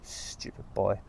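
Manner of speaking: whispered self-rebuke; annoyed whispered aside. Real, not acted. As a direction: mutter to yourself. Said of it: "self rebuke after misreading".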